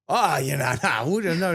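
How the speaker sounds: high, hurried acted-out backpedalling; mock-panicked squirming no; flustered denial. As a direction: fluster out a laughing refusal. Adam flustered, backpedalling from awkward offer.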